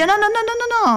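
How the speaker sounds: high, rushed no no no; frantic brahman stopping the tiger; mock panic; panicked protest.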